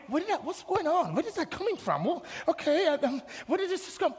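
high, thin puzzled voice; mock flustered, pitched well up; acted bewilderment. Weeper wonders where is that coming from, high and flustered. Acts bewildered person caught crying.

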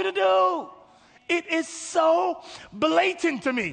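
high, insistent preaching voice; impassioned preaching. Real, not acted. Preacher tells the church why they were created, high and impassioned.

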